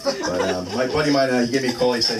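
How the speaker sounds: normal talking voice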